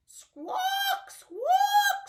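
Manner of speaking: very high, loud laughing squawks; shrieking parrot call, laughing. Acted. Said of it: "what sound does the parrot make?".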